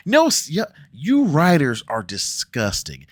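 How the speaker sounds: loud, slow disgust at the writers; disgusted outburst. Real, not acted. Vlogger yelling at the show's writers, loud and slow. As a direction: call the writers out, appalled.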